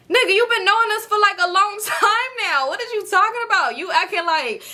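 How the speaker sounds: high-pitched, incredulous outburst with a laugh; exasperated disbelief. Retold or imitated. Storyteller reacts to Lamar claiming he barely knows them, exasperated. Snapping back at Lamar's excuse.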